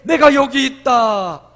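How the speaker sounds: God answering when called, slow, loud and majestic. Acted. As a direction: boom it out slowly as God. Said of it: "우리가 부를 때".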